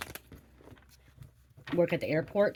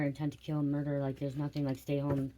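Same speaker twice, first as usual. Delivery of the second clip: ordinary delivery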